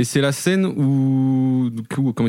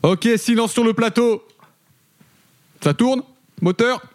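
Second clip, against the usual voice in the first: film director call; 7 semitones higher than usual. Acted. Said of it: "calling set like film director".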